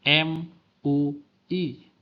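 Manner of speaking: "M U I" spelled out slowly, each letter separate. Shown as a demonstration. Teacher demo: "M U I" said as separate letters, slow. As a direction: lecturer shows students how to read acronyms, spelling "M U I" letter by letter, slowly. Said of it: "tanda-tanda pemenggalan untuk memudahkan pengucapan".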